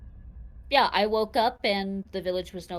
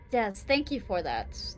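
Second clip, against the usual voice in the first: blunt. Acted. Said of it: "I think very bluntly".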